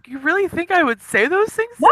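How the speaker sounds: high-pitched incredulous protest; offended disbelief. Acted. She shakes her head at him, high and incredulous. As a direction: push it up high, shaking your head at him. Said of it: "She shakes her head and she's looking at him like".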